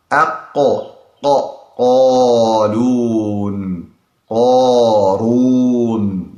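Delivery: qarun with the q let out slowly; slow qaf release. Shown as a demonstration. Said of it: "it takes time for the letter to go out".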